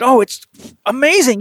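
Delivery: thrilled patient. Retold or imitated. Pitcher telling his doctor about rehab, excited and high. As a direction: gush about the new therapist. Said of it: "he was high as a kite".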